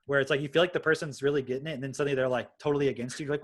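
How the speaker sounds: ordinary delivery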